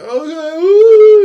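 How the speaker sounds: high, whimpering mock crybaby, rising to a loud wail on ooh; a hammed-up sniveling coward; crybaby whine. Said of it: "he's like a little crybaby".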